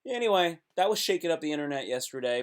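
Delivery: ordinary delivery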